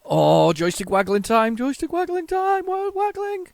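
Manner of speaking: loud call climbing to a squeal; straining cry rising to very high pitch; excited waggling cry. Real, not acted. Mock fanfare while waggling.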